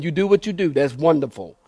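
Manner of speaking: ordinary delivery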